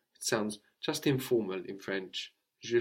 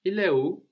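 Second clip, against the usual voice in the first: Il est où with où rising high at the end; informal question; 9 semitones higher, 5 dB louder than usual. Shown as a demonstration. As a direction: lift où into a bright casual question. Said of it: "question word on the end".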